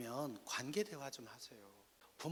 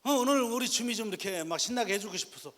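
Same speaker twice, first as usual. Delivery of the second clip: loud, high, overeager dad; imitating a father putting on big cheer; acted fatherly enthusiasm; 9 semitones higher, 12 dB louder than usual. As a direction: gush loudly about treating your child. Father cheering child by phone.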